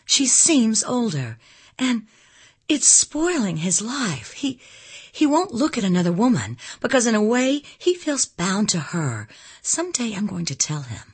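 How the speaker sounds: high, insistent outburst; impassioned. A narrator's reading. Elizabeth saying he is bound to Anne, impassioned. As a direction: argue ardently with long, stretched key words. Young woman's passionate argument about him.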